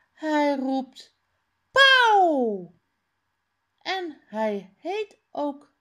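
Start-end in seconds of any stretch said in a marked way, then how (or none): 1.7-2.7 peacock call. Retold or imitated.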